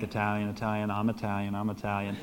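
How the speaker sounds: low, hushed repetition; softly muttered mock cover story; furtive insisting. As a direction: insist under your breath, trying to pass. Pretending to pass as Italian.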